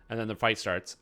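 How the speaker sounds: normal talking voice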